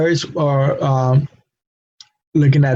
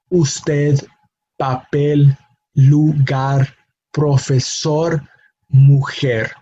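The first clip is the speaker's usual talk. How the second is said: usted, papel, lugar, profesor, mujer spaced out, each stressed on its last syllable; final-syllable stress; much slower than usual. Shown as a demonstration. Teacher repeats examples, stressing the final syllable of usted, papel, lugar, profesor, mujer. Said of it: "emphasis on that last syllable".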